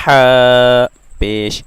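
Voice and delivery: ह drawn out slowly and loud from the middle of the throat; teacher spelling ह for students; slow throat letter drill. Shown as a demonstration. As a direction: stretch ह ज़बर slowly and loud from mid-throat. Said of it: "हलक के दरमियान वाले हिस्से से".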